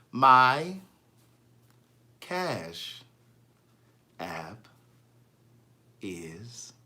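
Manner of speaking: low, soft, word by word; exaggeratedly slow, with long gaps between words. Shown as a demonstration. Host explaining her Cash App to Stephanie, very slowly. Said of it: "I'm gonna talk very slowly".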